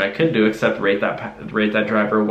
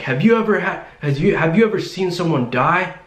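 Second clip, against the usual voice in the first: retold yelling; 7 semitones higher than usual. Passenger imitating the driver challenging her, mild mock yell. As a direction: imitate someone yelling an urgent question. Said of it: "he was, like, yelling at me".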